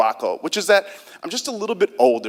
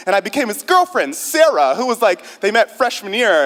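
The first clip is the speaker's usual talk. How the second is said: put-on lightly higher girlish voice; a hint of a college girlfriend, raised pitch; 6 semitones higher than usual. Shown as a demonstration. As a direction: play the sweet girlfriend, pitched up. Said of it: "she kinda talked like this".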